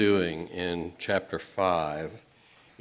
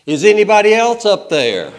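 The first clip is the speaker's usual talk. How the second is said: high, loud desperate call; yelling out; 12 semitones higher, 15 dB louder than usual. Acted. Man rejects the Lord's advice, loud high shout. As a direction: shout up for anyone else. Punchline, man calls up again.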